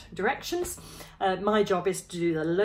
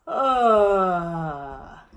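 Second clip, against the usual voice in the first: loud open ah sigh; low chest sigh; 8 dB louder than usual. Shown as a demonstration. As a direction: sigh ah with fingers on the jawline. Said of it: "do that sigh again".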